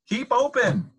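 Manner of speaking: high, loud command; yelling. A narrator's reading. Dentist inside the fox's quivering jaw, yelling. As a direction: yell the order urgently. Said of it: "yelled Doctor DeSoto".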